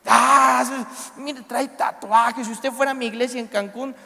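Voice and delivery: loud, drawn-out mock outrage; hammed-up judgmental outburst at a sinner; indignado. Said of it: "David se enoja y dice". (indignación fingida, fuerte y exagerada)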